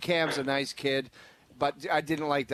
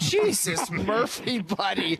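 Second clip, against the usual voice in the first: exasperated; 5 semitones higher, slower than usual. Retold or imitated. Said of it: "I was like".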